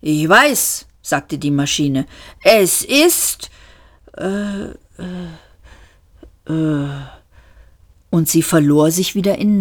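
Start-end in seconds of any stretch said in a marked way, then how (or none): 0.0-0.9 lightly voiced puzzled war machine; hesitant, groping for words; a touch of a dim robot; befuddled machine. A narrator's reading. 2.4-7.1 hesitant, stalling with long pauses; lightly voiced machine stuck for words; a touch of dim-witted puzzlement; befuddled machine. A narrator's reading. Machine lost in thought.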